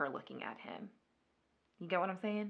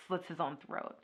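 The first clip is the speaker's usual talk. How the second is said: usual speaking voice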